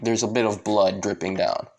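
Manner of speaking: ordinary delivery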